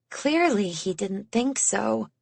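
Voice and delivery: ordinary delivery, nothing marked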